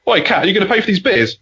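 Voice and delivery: lightly acted pub challenge to a cat; mock challenge. Mate turns round to the cat at the bar, mock challenging.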